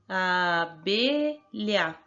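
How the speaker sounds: "abelha" stretched out as a, be, lha; very slow classroom sounding-out of "abelha"; slow syllable-by-syllable. Shown as a demonstration. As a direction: break "abelha" into three long, separate syllables.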